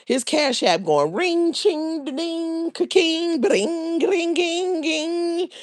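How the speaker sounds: high, sing-song ching da-ding; playful high ringing imitation; cash app chimes.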